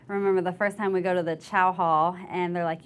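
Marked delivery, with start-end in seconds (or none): none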